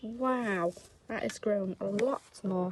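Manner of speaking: plain reading voice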